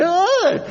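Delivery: put-on high-pitched girlish scream; short, shrill-pitched mock scream; father's scream. Father caught on replay, screaming like a little girl. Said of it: "you hear the father scream".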